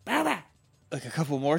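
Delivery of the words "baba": high squeaky creature call; Pokemon cry. Retold or imitated. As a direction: mimic the little Pokemon's call.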